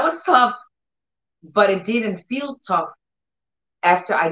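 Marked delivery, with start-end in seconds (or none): none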